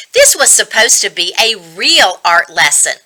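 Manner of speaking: ordinary delivery, nothing marked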